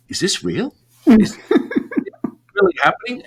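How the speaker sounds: high-pitched disbelief, broken by pauses; incredulous. Retold or imitated. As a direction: act out dazed questioning of what you see. Disbelief at teacher's reaction.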